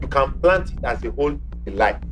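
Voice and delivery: ordinary delivery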